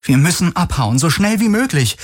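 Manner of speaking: high, pressing plea; urgent. A narrator's reading. Stowaway pressing the captain to flee, urgent. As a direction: climb in pitch, urging escape. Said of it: "drängte Kirai".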